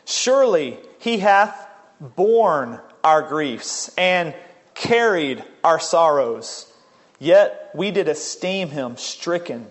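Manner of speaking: slow reading, weight on "borne" and "carried"; emphasized scripture reading. Real, not acted. Preacher reading Isaiah so listeners notice the burden, emphatic. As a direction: set "borne" and "carried" apart with pauses and stretch them. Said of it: "I'll try to emphasize the words".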